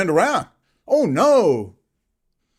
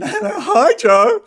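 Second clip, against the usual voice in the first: mock fawning; 12 semitones higher, 3 dB louder than usual. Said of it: "mock greeting".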